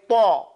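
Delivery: "Thaw" with round, oval lips. Shown as a demonstration. Teacher modelling for the student, rounded "Thaw". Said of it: "You see the difference?".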